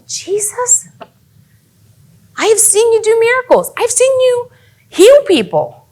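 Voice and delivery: high-pitched, pleading reproach; desperate plea. Acted. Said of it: "when Lazarus is laying there".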